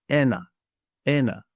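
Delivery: "ena" with the first syllable stressed. Shown as a demonstration. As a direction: hit the opening e of "ena", let the end drop. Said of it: "of the stress on the e".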